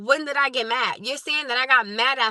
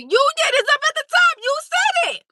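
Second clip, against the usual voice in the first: much higher and louder, near a shriek; exasperated; 7 dB louder than usual. Real, not acted. Streamer insisting the chatter wrote it, shrill exasperated outburst. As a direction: fire it back in disbelief. Exasperated retort to chat denial.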